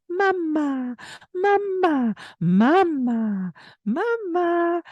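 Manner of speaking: high repeated mama; baby talk. Shown as a demonstration. Parent in baby's face, high coaxing mama. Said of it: "all in her face going".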